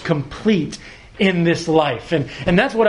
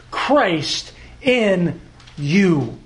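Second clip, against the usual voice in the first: Christ in you, drawn out slowly and weighted; slow emphasis; much slower than usual. Acted. Preacher to congregation, slow weighted emphasis. Preacher slows on key phrase.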